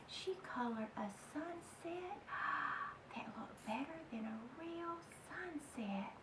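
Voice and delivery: hushed wonder. A narrator's reading. Narrator marvelling to the children, in a hushed whisper. Storyteller awed at the sunset.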